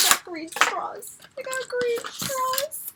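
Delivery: soft, slow squeal climbing high; hushed delight. Real, not acted. Fan repeating she got green straws, hushed delight. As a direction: squeak it slowly and softly.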